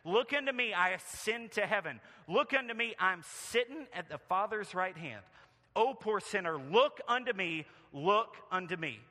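rhythmic refrain, each "Look unto me" pitched high, then dropping; country preacher. Acted. Country preacher pleading with poor sinners to look, high rhythmic preaching. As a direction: hammer out the repeated appeal like a country preacher. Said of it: "followed up his text in this way".

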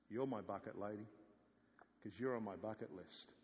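low, soft, unhurried voice of Jesus, tender and sure; gentle Jesus voice. Acted. Jesus softly reassuring the woman at the well, low and tender. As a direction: speak it low and gently, as a secret kept for her.

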